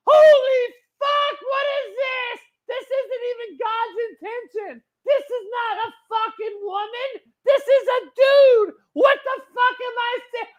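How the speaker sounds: a newborn on a hospital bed screaming at the man feeding him, high and crying; outraged newborn. Pretend. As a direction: rant like a furious newborn, voice way up. Voicing the newborn baby's outrage.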